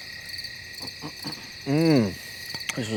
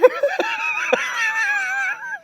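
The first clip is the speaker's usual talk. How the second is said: high shrieking laugh; 8 dB quieter than usual. Real, not acted. Laughing at own rant.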